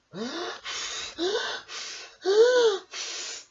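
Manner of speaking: high, loud theatrical gasp; big gasp. Acted. Storyteller gasps loudly for the children at the surprise. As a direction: gasp in mock delight. Gasp before the island arrives.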